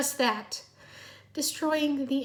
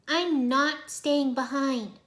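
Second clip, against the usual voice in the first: slow, stubborn refusal; 3 semitones higher, 4 dB louder, much slower than usual. A narrator's reading. Irene refuses to stay behind, stubborn. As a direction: dig in your heels. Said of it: "she said stubbornly".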